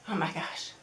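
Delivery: words almost fail as tears come close; choked up. Real, not acted. Thanking viewers for checking in after the Texas freeze, choked up. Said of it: "before I get really emotional".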